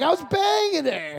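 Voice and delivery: a hint of a smug friend, high and slow; smug confession. Comic imitating his friend admitting the affair, lightly voiced and slow. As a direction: let it drop slowly, pleased with himself. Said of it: "he's like".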